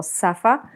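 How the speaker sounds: "safa" with plain s in place of sz; child's hissing substitution. Shown as a demonstration. Therapist showing parents a toddler's substitution, "safa" with plain s. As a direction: say "safa", first sound a plain s. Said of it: "jeśli maluch zamiast słowa szafa".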